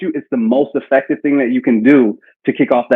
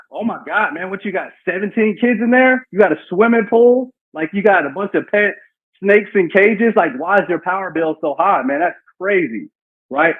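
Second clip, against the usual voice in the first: higher, playful disbelief at the bill; silly mock shock; 4 semitones higher than usual. Shown as a demonstration. Rep to the customer over the bill: higher playful mock shock. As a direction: ham up the shock like a joking friend. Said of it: "don't be afraid to be silly".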